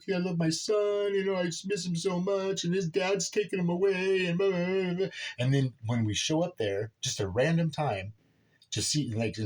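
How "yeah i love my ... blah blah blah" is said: high, whiny mock-sorrowful mom; hammed-up pleading, pitched way up; mocking mom's narrative. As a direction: mimic her court sob story in a high voice. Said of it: "in court and the whole narrative".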